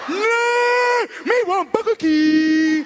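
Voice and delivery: high, loud, slow shouted demand; brother impression. Brother refusing McDonald's, high loud shout. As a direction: bellow it high like a stubborn child.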